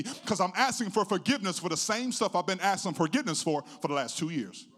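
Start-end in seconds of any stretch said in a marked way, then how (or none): none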